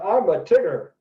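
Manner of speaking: put-on a boy standing on his seat yells to the teacher; yelled out. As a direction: shout it out standing up in class. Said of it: "yelled out".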